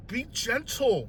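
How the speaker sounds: high, soft, slow plea; pleading. Retold or imitated. Patient begging tough doctor, soft high plea. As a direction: plead gently, drawing the words out.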